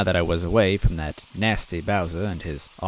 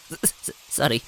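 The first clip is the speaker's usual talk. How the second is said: stammered apology; 5 semitones higher than usual. Acted. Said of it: "I said".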